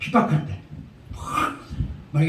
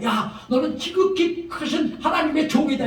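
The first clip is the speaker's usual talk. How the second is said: acted crying out; 6 semitones higher than usual. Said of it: "이렇게 말합니다".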